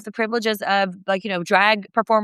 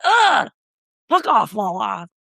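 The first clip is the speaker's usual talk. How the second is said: swat her away in disgust; fed-up brush-off. Retold or imitated. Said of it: "And I was like".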